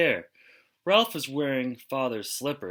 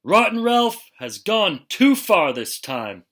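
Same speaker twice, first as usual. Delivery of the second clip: higher, louder bellow; 8 semitones higher, 8 dB louder than usual. Acted. Father bellowing after Ralph wrecks the circus, furious. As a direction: bellow it in fury. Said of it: "bellowed father".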